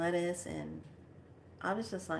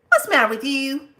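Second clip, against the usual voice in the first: loud, high what's the matter; playful scolding; 6 semitones higher, 11 dB louder than usual. Real, not acted. Telling off Parley, loud and teasing. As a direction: scold the pet fondly.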